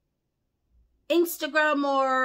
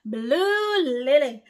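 slow, drawn-out name, louder; stretched call; 2 semitones higher, 5 dB louder, slower than usual. Real, not acted. Preacher calling a follower's name out slowly and loudly. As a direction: call the name out long again. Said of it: "I'm gonna lose my voice over Blue Lily".

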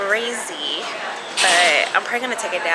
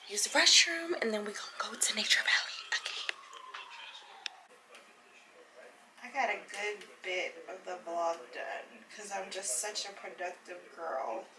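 soft hushed whisper; 12 dB quieter than usual. Real, not acted. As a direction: keep it down, whisper it. Whispering in the restroom.

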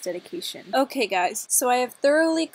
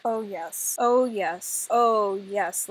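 normal talking voice

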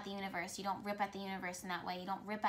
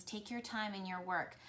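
ordinary talk

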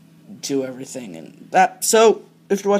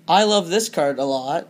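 normal talking voice

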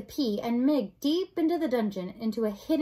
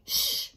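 hushing shh. Acted. Princess quiets crying Mig, shushing. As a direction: hush her with a shh.

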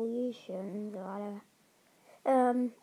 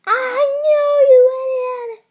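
yell; 18 dB louder than usual. Real, not acted. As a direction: bellow it at him. Yelling at friend found.

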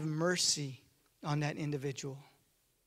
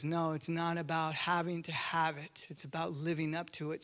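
quiet firm refusal; 4 semitones lower, 7 dB quieter than usual. Acted. David refusing to kill Saul.

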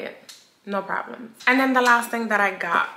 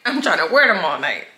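laughing; 7 dB louder than usual. Real, not acted. Laughing about heels.

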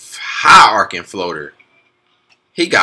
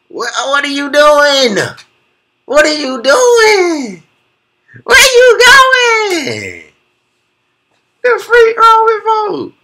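much higher and louder, very slow, crying out; screaming disbelief; 6 dB louder, much slower than usual. Real, not acted. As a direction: wail at the defender's fall like it hurts. Defender falls, reviewer loses it.

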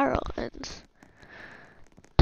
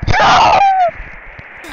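high, loud panicked "no"; startled scream; 10 dB louder than usual. Real, not acted. Player screaming at the game, high shout. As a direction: scream it out in sudden alarm.

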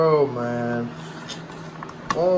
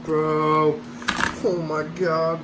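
ordinary talk

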